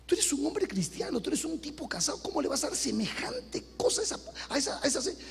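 soft, half-whispered rebuke; hushed scolding. Retold or imitated. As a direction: scold him under your breath. Pastor scolding the man quietly.

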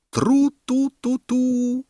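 high, sustained tooting; horn call. A narrator's reading. Horn sounding suddenly in the silent circus, high tooting. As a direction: toot like a herald's horn. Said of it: "rozległ się dźwięk rogu".

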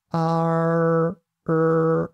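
long あー curled into R, brief silence, then うー as pure "rrr"; "ah-r", stop, R. Shown as a demonstration. Teacher showing the next step, retroflex R after silence. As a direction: hold the tongue curl through the break and voice うー. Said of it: "一旦黙って、 そしてまた声を出します".

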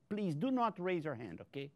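ordinary talk, nothing marked

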